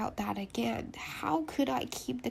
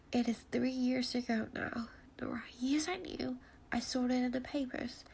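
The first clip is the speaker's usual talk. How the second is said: usual speaking voice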